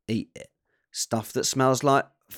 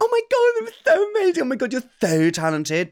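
high gushing fan voice; mock adoration; 10 semitones higher, 3 dB louder than usual. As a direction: gush over a performance. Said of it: "everyone would be like".

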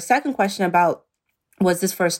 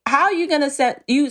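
ordinary talk, nothing marked

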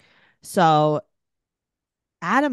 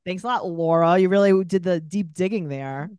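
sarcastic thanks; 5 dB louder than usual. Retold or imitated. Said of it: "It's like".

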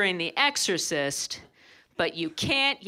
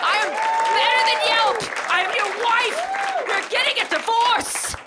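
much higher, louder comic rant; mock outrage; 14 semitones higher, 7 dB louder than usual. Wife furious her husband trusts Yelp over her, ranting much higher and louder. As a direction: build to an indignant shriek.

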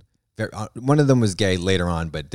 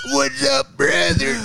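mock bro shout; 6 semitones higher, much slower than usual.

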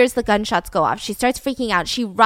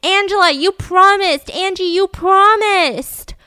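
high, loud cry with a drawn-out last word; retold scream; 8 semitones higher, 5 dB louder than usual. Boy yelling as he chases his sister, screaming accusation. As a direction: imitate a desperate yell, stretching the final word.

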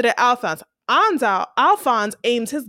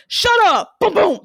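sudden loud, high snap; mock shout; 8 semitones higher, 5 dB louder than usual. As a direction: bark it like a thug cutting him off. Alphonse snaps in retold scene.

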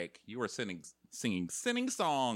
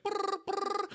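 mock computing noise. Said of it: "And she's like".

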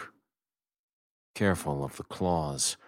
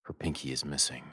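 ordinary delivery

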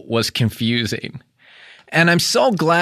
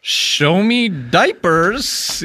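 Steve Harvey voice; 5 semitones higher, much slower than usual. Retold or imitated. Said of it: "he's doing the Steve Harvey".

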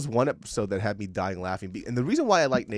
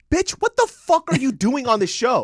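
much higher and loud, fed-up disbelief; incredulous outburst; 13 semitones higher, 8 dB louder than usual. Retold or imitated. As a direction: blast her in angry disbelief. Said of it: "And he's like".

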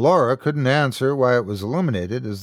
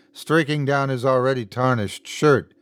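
ordinary delivery, nothing marked

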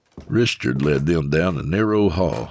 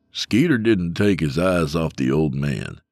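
ordinary delivery

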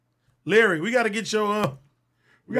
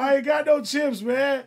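shouting back; 7 semitones higher, 5 dB louder, slower than usual. Real, not acted.

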